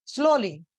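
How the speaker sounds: "Slowly" with SLOW emphasised, lee dropping; stress on first syllable. Shown as a demonstration. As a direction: punch the start of "slowly". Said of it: "slow पे कहीं ना कहीं ज्यादा stress". ("Slowly" के "slow" पर ज़ोर देकर)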